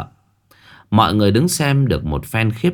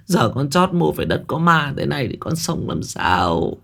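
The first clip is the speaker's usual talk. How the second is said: put-on high, wailing lament of a distraught old woman; 5 semitones higher than usual. Bà Đót lying on the ground wailing, higher lament. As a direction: cry out your despair.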